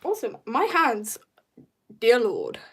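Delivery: ordinary delivery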